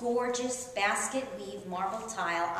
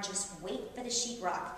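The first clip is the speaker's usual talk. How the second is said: hushed, softer doom-laden warning; lightly spooky, like a dire prophecy; mock-ominous hush; 3 semitones lower, 9 dB quieter than usual. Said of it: "With ominous voices".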